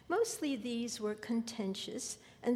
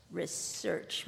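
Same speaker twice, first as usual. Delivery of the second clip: mocking; 5 semitones lower than usual. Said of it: "my father mocked".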